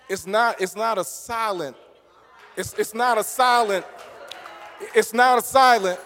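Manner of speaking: builds from plain to full shouting, repeating the phrase; building shout. Real, not acted. Preacher to the congregation, building to a shout. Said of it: "a quiet thing".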